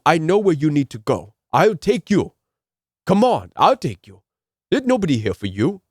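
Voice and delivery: imitating a Haitian accent, insistent; a stranger at the airport urging a ride in a Haitian accent; persistent Haitian accent. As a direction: push him to get in the car, Haitian accent. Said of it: "And he was persistent".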